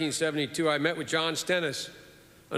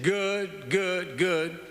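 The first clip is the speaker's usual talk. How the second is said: Senator Stennis voice; 4 semitones higher, much slower than usual. Retold or imitated. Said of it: "he always talked like this".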